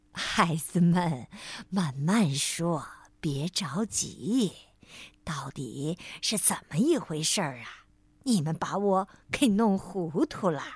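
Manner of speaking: put-on kindly old bear granny. A narrator's reading. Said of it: "熊婆婆笑呵呵地说".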